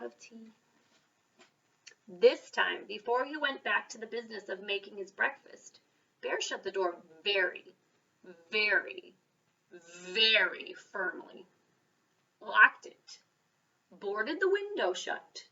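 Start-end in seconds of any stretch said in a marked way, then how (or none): none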